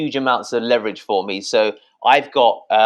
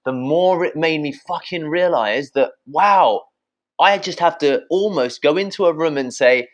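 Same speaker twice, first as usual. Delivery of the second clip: ordinary delivery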